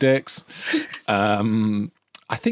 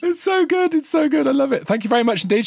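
high, delighted gushing; 3 dB louder than usual. Real, not acted. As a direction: gush with real delight.

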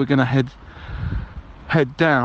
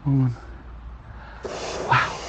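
ordinary talk, nothing marked

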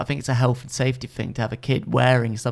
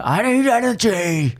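desperate plea; 9 semitones higher, 4 dB louder than usual. Retold or imitated. Voicing Steve desperate.

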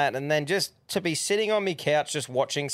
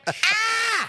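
very high, sudden yelp; re-enacted shriek. Fan retelling his shock at the winger's play, high shriek. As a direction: let out a high "ah!".

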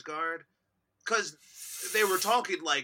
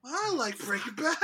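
laughing; 8 semitones higher than usual. Real, not acted. Laughing confession.

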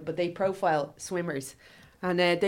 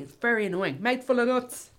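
ordinary talk, nothing marked

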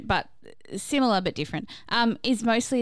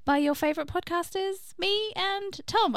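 high voice; 10 semitones higher than usual. Real, not acted. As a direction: let the pitch creep up high, slowing on the names. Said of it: "my voice got really high then".

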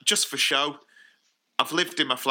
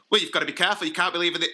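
nagging mum; 5 semitones higher, 4 dB louder, much faster than usual. Retold or imitated. Mum's worried nagging, retold.

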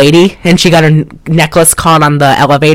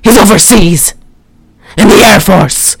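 higher, hammed-up melodramatic line; overacting old lady; 3 semitones higher than usual. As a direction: overact it like a bad movie actress. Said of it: "She's so overacting".

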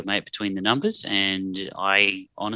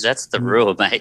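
normal talking voice

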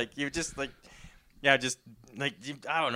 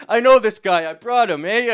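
phony casual; 7 semitones higher, 11 dB louder than usual. Retold or imitated. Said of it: "I sound so phony".

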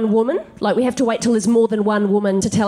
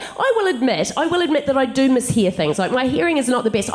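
ordinary talk, nothing marked

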